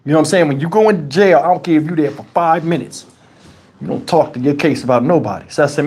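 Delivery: ordinary talk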